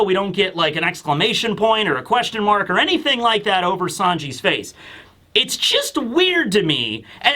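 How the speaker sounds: normal talking voice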